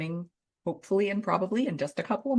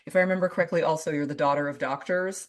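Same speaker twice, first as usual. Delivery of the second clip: normal talking voice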